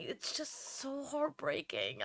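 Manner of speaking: fighting to keep from crying; holding back tears. Real, not acted. Streamer telling viewers about the sentencing, holding back real tears. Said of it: "trying to hold my tears back".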